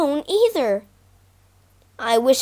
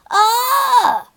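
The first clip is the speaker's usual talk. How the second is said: high loud cry of alarm; startled shout; 11 semitones higher, 4 dB louder than usual. Acted. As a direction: yelp as the net drops. Lion caught in net.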